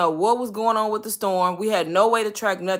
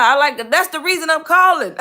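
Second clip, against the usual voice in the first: exasperated retort; 8 semitones higher, 7 dB louder than usual. Retold or imitated.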